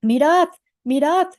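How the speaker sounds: loud, high narrated shout. A narrator's reading. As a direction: call it out to everyone, each word rising. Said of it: "gritó Teroro".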